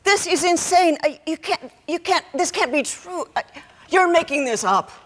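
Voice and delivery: high, faltering protest; distraught denial. Acted. Mother refusing daughter's confession, high and distraught. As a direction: stumble over the words in rising distress.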